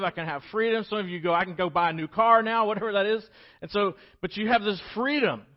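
high, loud jubilant preacher; exultant sermon peak; full-force preaching. Acted. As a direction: shout out the freedom.

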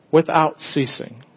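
ordinary talk

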